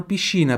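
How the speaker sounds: piscina with sci said as sh; Italian sci. Shown as a demonstration. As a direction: piscina as pi-shi-na. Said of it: "S C I tem som de chi". (piscina com sci soando chi)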